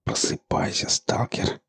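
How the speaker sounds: unvoiced urgent whisper. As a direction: whisper him awake. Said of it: "прошептал Марк".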